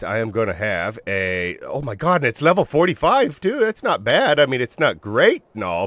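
ordinary talk

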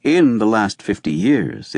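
usual speaking voice

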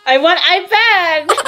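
put-on high, slow whine, like a toddler begging; a toddler wailing for the iPad; whiny toddler demand. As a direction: drag out the demand like a spoiled kid. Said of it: "I'm gonna cry for absolutely no reason".

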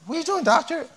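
high, lightly voiced nurse; a hint of a puzzled nurse; surprised nurse. Nurse finding the doctor taking out trash, lightly surprised and high. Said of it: "nurse came in and said".

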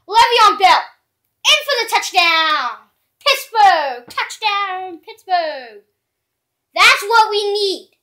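loud, high touchdown yells; excited cheering. Real, not acted. Fan cheering his player's touchdown, loud excited yells.

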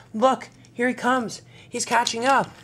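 high-pitched urgent warning; alarmed. Acted. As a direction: warn that danger is closing in. Thomas sees the pursuer coming.

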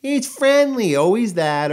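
high, loud mock owner voice; sing-song mock of a dog owner. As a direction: imitate the careless owner chirping. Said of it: "always gonna be followed by or preceded by".

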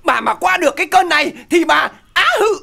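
loud, high mock-crying threat with strained grunts; imitated labour cursing. Husband mimics his wife cursing through labour pains, crying loudly. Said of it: "À, nó chửi là".

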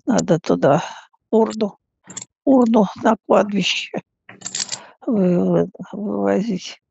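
ordinary talk

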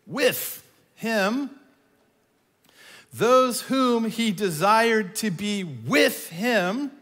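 slow, drawn-out reading with with him leaned on; slow emphatic reading; stressing with him. Shown as a demonstration. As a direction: read it slowly and stretch every with him. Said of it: "Trying to make a point there".

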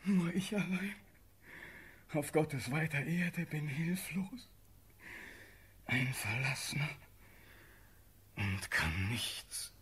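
sinking into low, hushed despair; forlorn and hushed. Acted. Condemned prince confessing helplessness to his aunt, forlorn and hushed. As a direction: voice drops away, slowing at the end.

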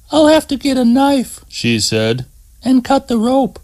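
high quick woman's voice, thinking aloud; practical, working out the fix; Terry's voice. Acted. Terry tells trapped Scott she will fetch a knife, high voice.

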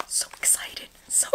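breathy unvoiced whisper of glee; excited whisper. Real, not acted. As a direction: hush your excitement.

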